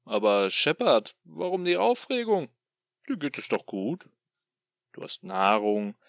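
loud, sneering demon voice; gloating tormentor taunting his captive; mocking demon. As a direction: toy with him like a cruel god.

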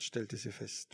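ordinary delivery, nothing marked

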